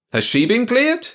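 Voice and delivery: high, loud challenge; loud countering. A narrator's reading. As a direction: fire the question back loudly. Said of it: "countered in a loud voice".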